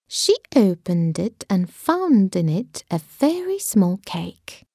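ordinary delivery

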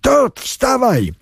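high, slow urgent yell; urgent shout. Acted. Tom urges Todd to get up, slow high yell. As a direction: drag the words out in a desperate call. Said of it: "krzyknął Tom".

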